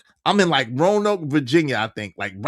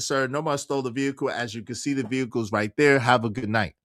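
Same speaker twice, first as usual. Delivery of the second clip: fake polite calm; 6 semitones lower, 6 dB quieter, faster than usual. Calm reply to officer.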